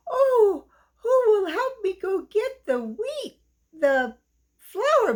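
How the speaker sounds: very high, eager hen voice with a whiny edge; a thrilled hen rallying helpers; excited little red hen. Acted. Happy hen asks for help.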